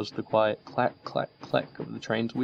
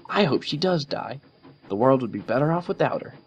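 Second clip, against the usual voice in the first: hard, cold Rainbow; 6 semitones higher than usual. A narrator's reading. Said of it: "Rainbow's face hardened".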